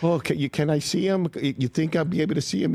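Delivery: high, excited fan squeal; starstruck gushing. Retold or imitated. New York waitress hearing her favorite is in the movie, gushing.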